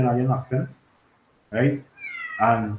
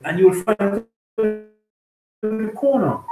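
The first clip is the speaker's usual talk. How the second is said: lightly mimicked American accent, higher and slow; put-on American accent; 6 semitones higher, slower than usual. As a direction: mimic a vendor suddenly faking an American accent, drawn out. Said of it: "the man accent change".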